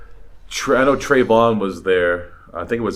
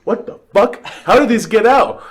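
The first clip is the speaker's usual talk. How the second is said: higher, louder startled outburst; mock alarm; 12 semitones higher, 6 dB louder than usual. Movers seeing the dogs escape, higher startled outburst. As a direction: react like the dogs just bolted past you.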